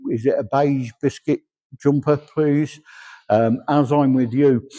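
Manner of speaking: normal talking voice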